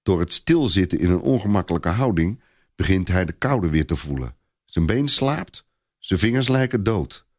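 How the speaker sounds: ordinary delivery, nothing marked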